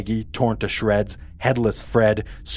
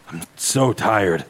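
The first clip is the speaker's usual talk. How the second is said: exhausted man; slower than usual. Acted.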